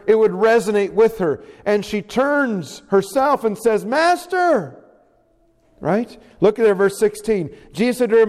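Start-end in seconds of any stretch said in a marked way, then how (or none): 3.9-4.8 high, astonished cry without shouting; joyful recognition. Acted. Mary turns and recognises her risen Lord, high exclamation. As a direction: exclaim it high as you suddenly recognise him.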